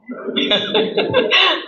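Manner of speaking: a short high chuckle at his own joke; high laugh. Real, not acted. Preacher chuckles to the audience about going every year, short high laugh. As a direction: giggle lightly after the punchline.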